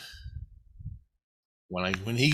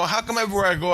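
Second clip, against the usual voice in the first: higher, louder exasperated cry; exasperated outburst; 10 semitones higher, 6 dB louder, much faster than usual. Retold or imitated. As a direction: burst out in disbelief.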